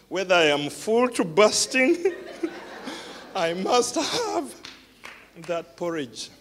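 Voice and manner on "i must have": normal talking voice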